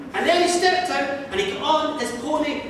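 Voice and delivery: ordinary delivery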